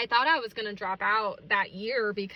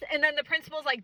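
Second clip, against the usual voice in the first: ordinary delivery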